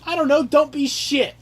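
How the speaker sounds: high mocking parody of bad advice. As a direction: sneer it like a snotty guidebook.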